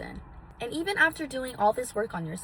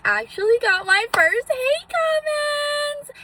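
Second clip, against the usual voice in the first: high, loud sing-song squeal; theatrically thrilled, stretched out long and high; mock celebration; 9 dB louder, slower than usual. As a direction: draw it out in a very high, ringing voice. Mock-celebrating her first hate comments.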